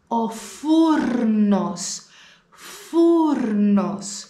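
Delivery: φούρνος slow, ρ before ν rolled; slow model word. Shown as a demonstration. Teacher stresses the ρν cluster, slow. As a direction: say φούρνος, ρ sounded. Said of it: "ρο πλας νι".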